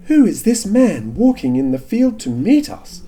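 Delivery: lightly voiced young woman; high girlish Rebekah voice. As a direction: raise the pitch for Rebekah. Rebekah voiced in Bible reading.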